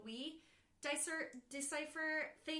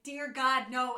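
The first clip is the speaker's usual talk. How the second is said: a hammed-up horrified cry, louder; louder mock panic at a monster; mock horror; 11 dB louder, slower than usual. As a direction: play the horror-movie victim. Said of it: "you're like".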